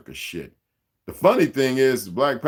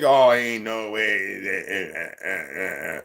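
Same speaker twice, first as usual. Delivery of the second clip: slow, drawn-out doubting voice, then scoffing; lightly mocking disbelief with little scoffs; mock doubter; 3 semitones lower, slower than usual. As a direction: play the sceptic who won't believe it. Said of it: "some of you just think".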